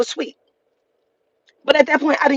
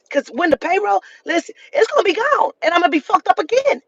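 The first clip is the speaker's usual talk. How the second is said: agitated; 7 semitones higher than usual. Real, not acted. Panicking about payroll.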